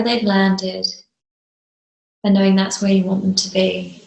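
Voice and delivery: calm meditation voice with long, restful pauses; guided meditation. Real, not acted. Teacher settling listeners into a grounding visualization, calm meditative delivery. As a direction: guide them gently, phrase by phrase, leaving space between.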